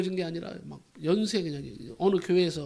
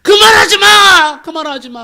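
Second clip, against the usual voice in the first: long high scream that drops off; acted shriek; 12 semitones higher, 19 dB louder, slower than usual. Possessed young man cries out at the rebuke, acted scream. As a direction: shriek the plea, then let it sag. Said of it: "비명소리를 지르면서".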